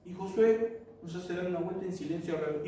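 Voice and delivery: voz baja de quien piensa acostado; pensando para sí. Acted. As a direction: decilo bajito, como un pensamiento antes de dormir. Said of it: "irte a dormir a la noche pensando". (pensamiento nocturno actuado, en voz baja)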